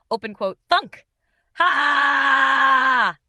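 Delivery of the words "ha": one huge barking laugh, high and loud; shouted ha. Acted. A janitor's booming laugh echoes off the lockers. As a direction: blast a single laugh down the hallway. Laugh after sign falls.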